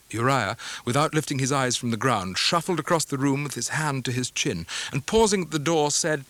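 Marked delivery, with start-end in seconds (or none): none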